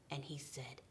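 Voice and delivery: ordinary delivery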